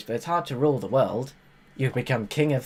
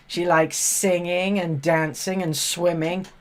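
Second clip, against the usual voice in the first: normal talking voice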